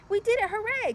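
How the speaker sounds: high, loud hooray; cheering. Acted. Tiny celebrates finishing deliveries, high and loud cheer. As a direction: celebrate the win.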